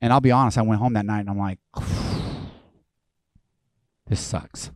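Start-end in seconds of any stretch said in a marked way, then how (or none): 1.8-2.5 long unvoiced exhale; heavy sigh. Pastor home that night facing a choice, heavy sigh. 4.1-4.7 low, soft, resigned mumble; dejected. Retold or imitated. Pastor home that night facing a choice, dejected.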